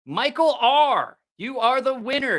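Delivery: stretched, high winner call; hyped giveaway announcement. Real, not acted.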